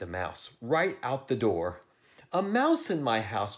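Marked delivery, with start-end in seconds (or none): 2.3-3.6 high, loud story-character exclamation; pitched-up storybook outcry; crying out. A narrator's reading. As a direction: cry it out, starting high. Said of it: "cried Miss Perkins".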